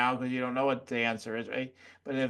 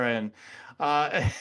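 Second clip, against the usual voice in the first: ordinary talk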